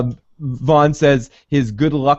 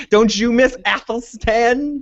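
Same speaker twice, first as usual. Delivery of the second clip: mock sinister Ragnar; menacing double talk; 8 semitones higher than usual. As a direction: lean on every word like a veiled threat. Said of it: "giving him the".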